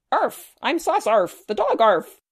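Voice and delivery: high, loud yapping dog voice; barky dog voice. Acted. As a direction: bark the words like a playful puppy. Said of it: "in a barky sort of voice".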